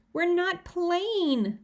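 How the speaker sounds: high, very slow screamed protest. A narrator's reading. Sister protesting to the mother: high, slow wailing scream. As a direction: wail it out in protest. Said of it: "Libby screamed".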